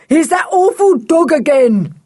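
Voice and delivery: high, loud yell of alarm. A narrator's reading. Block seeing the dog arrive, yelling. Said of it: "yelled Block".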